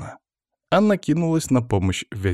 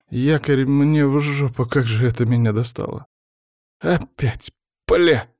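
exasperated cursing; 4 semitones higher than usual. A narrator's reading. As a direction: curse as if fed up with it. Collector curses against his will.

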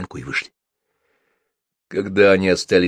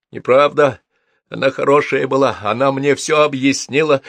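louder, higher insistence; 7 semitones higher, 7 dB louder than usual. A narrator's reading. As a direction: push back louder now. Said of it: "уже громче сказал Егор". (громче и выше, настойчиво)

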